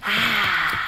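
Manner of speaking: usual speaking voice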